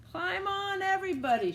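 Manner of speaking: high, loud call to climb aboard; shouting duck. A narrator's reading. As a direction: call everyone on the tractor. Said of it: "shouted Duck".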